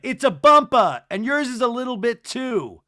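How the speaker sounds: loud, high retort; mock indignant shout. Angie answering Whitney's look, loud mock-indignant shout. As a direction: call it out in imitated outrage. Retold argument, Angie insisting.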